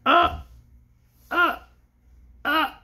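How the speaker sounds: high stuttering wind-down; stalling toy. Acted. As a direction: sputter like a toy running down. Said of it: "said the pink bear, and then stopped short".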